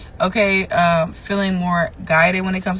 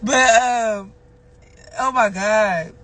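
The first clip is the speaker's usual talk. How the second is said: ordinary delivery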